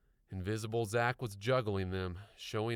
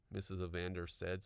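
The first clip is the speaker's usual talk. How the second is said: ordinary delivery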